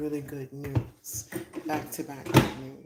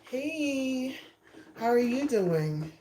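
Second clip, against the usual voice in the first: excited; 6 semitones higher, slower than usual. Real, not acted. Greeting live viewers joining.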